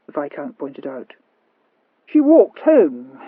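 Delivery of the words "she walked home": slow explaining. Acted. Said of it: "explained Sir Rowland".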